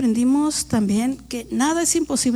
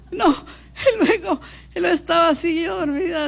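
laughing while telling; 5 semitones higher than usual. Real, not acted.

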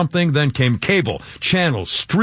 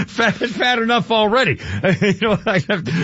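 laughing through the words, voice pitched up; 5 semitones higher than usual. Real, not acted. Host laughing at his own joke about getting fat. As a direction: chuckle out the self-mocking line.

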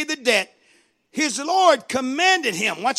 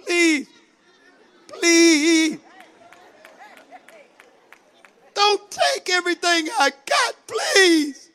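pleading higher and higher, voice cracking; begging servant; 5 semitones higher than usual. Acted. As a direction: beg the master for mercy, desperate. Preacher acts servant begging master.

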